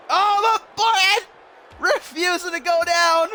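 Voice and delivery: high, amazed ringside call; commentator's rising astonished shout; excited commentary cry. Real, not acted. Commentator reacts to wrestler surviving.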